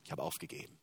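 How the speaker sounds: half-whispered, giving up; resigned whisper. Retold or imitated. Retelling a despairing church member, whispered. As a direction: breathe it out like someone who has quit. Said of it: "dann sagte".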